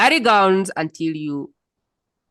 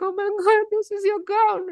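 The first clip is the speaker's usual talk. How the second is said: imitating a crying woman in a very high voice; high-pitched mock weepy exclamation; tearful awe. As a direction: put on an overcome, tearful squeal. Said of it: "and she was crying".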